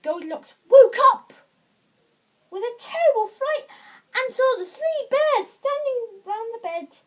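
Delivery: high, loud, dramatic storytelling; alarmed narration. A narrator's reading. Storyteller as Goldilocks wakes to bears, alarmed. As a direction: raise the voice for the frightening moment.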